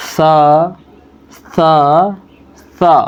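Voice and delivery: "سا" with the tongue tip between the teeth; letter demo. Shown as a demonstration. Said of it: "سا اس طرح سے ادا ہوگا".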